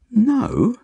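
put-on very high little-girl objection; interrupting child. A narrator's reading. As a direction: cut in with a high, indignant no. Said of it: "she interrupts".

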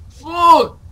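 sharp high angry shout; frustrated yell. Real, not acted. Frustrated gamer fails climb.

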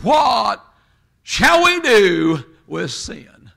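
slow, booming evangelist preacher voice; Billy Graham impression. Preacher asking the crowd, in a slow booming evangelist voice. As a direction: draw out each word like a crusade preacher. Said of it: "turned his voice in the voice of Billy Graham".

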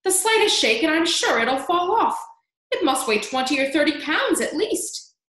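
loud, high-pitched warning; alarmed yell. A narrator's reading. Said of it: "Aunt Spiker yelled".

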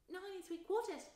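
put-on high little girl's voice; piped small girl. Small girl answering her mother, piped high. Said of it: "piped a small girl".

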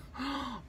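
quick soft gasp of delight. Real, not acted. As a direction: gasp at the pretty colors. Sees colors.

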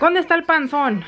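retold scolding. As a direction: call out crossly, retelling it. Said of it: "los empecé a regañar". (reclamo agudo, imitado)

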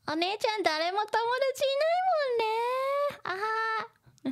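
put-on high teasing child voice; giggly little girl mocking her sister. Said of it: "ふたりちゃん's laughing".

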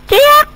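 a sharp high bird screech; shrill attacking chirp; bird squawk. Acted. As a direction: screech like an angry little bird.

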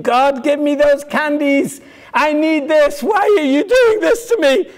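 put-on childish sobbing demand for candies; whiny screaming plea. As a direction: wail like a spoiled child. Tantrum screaming at God.

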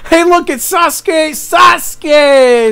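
high, loud call of a name; excited shout. Real, not acted. Fan spotting Sasuke on screen, high excited shout. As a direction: shout out in delighted recognition. Calls out a recognised character.

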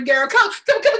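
ordinary delivery

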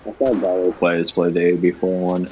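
usual speaking voice

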